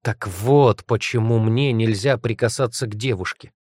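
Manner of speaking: ordinary talk, nothing marked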